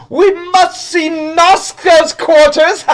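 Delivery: very high, slow and loud; grand announcement. Acted. Guide shouting to the group about the next room. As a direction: proclaim it like a giddy herald. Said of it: "And he goes".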